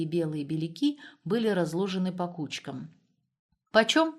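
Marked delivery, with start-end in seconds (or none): 3.7-4.1 offhand question, pitched up; a lightly careless price check; casual. A narrator's reading. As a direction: toss it out casually, higher. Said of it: "небрежно спросила она".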